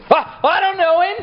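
high, sudden denial, voice jumping up; Peter's panicked denial. Retold or imitated. Peter blurting to the servant girl, a high panicked denial. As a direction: blurt it out like a cornered man.

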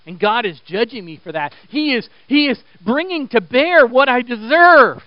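building to a loud, high lament; rising preacher's dramatization of guilt; acted self-condemnation. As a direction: voice a guilty believer, swelling to full force. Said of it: "some of you are going".

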